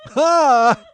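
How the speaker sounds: loud disbelieving "Ha!"; incredulous bark of laughter. Retold or imitated. Comic hearing a huge quote, loud scoffing laugh. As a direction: scoff loudly at the price.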